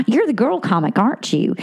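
ordinary talk

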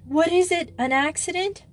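high, frightened question; acted alarm, pitched well up; alarmed gasp. A narrator's reading. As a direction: ask it fearing the worst. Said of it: "she gasped in whitening face".

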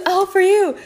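high, loud mock wail; dramatic parent. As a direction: ham up the parental sacrifice. Redoes dramatic parent line.

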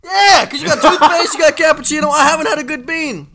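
high, loud laughing protest; loud laughing complaint. Real, not acted. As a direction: complain loudly through laughter. Laughing, complaining about bad beans.

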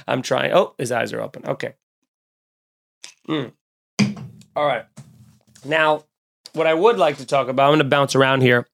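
normal talking voice

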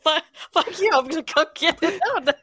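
high, loud, laughing indignation; playful outrage. Real, not acted. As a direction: fire back high and loud, half laughing. Banter after being tricked.

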